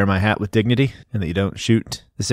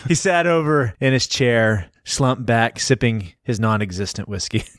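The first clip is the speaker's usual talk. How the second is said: normal talking voice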